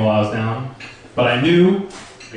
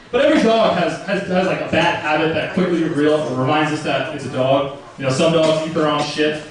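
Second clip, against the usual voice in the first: normal talking voice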